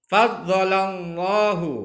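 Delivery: fadzalallahu with a thick, heavy lam, slow and loud. Shown as a demonstration. Teacher showing pupils the heavy lam, slow and loud. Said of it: "lam jalalah dibaca takhim".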